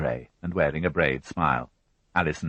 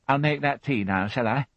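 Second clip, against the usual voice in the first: lightly raised fussy housewife voice; a higher, brisk widow answering the policeman; Mary Rothwell voiced; 9 semitones higher than usual. A narrator's reading. Widow answering the inspector, a higher fussy voice.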